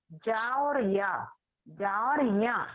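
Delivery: slow, separated जा और या; sounds spelled out. Shown as a demonstration. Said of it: "ये ज्ञा जो है वो संयुक्त व्यंजन है". (जा और या धीरे धीरे अलग अलग)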